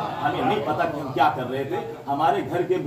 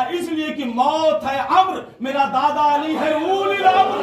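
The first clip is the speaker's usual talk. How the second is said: high, loud, slowed punchline; climactic preaching; 5 dB louder, slower than usual. Acted. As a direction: ring out the final line to the crowd. (خطاب کا عروج، بلند آواز میں)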